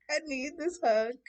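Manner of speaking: high-pitched mock-sobbing plea; tearful pleading. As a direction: whine it out, hammed-up and high. Clinging to a hug, upset.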